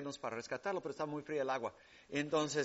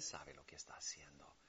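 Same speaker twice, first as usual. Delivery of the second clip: hushed, unvoiced whisper; 11 semitones lower, 9 dB quieter than usual. Real, not acted. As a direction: breathe it as a secret. Whispered statement of faith.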